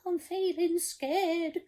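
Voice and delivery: high, trembling scared cook voice. Acted. Storyteller voicing the cook, scared of the sea, high voice. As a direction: quiver like a frightened wimp. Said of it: "the cook declared".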